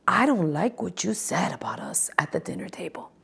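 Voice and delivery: hushed whisper airing a grievance; whispered complaint. Retold or imitated. Recapping Summer House, Ben telling Bailey off in a whisper. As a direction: say it under your breath, aggrieved.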